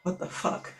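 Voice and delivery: unvoiced, stunned whisper; whispered disbelief; whispered panic. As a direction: breathe it out in shock. Panicked inner thought.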